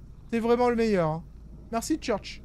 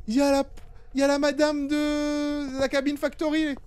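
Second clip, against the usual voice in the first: panicking gamer; 6 semitones higher than usual. Real, not acted. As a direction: point it out in alarm. Sees scary lady in game. (voix aiguë et effrayée)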